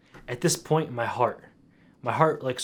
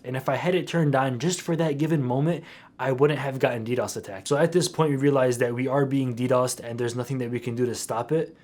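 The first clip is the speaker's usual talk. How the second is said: ordinary talk, nothing marked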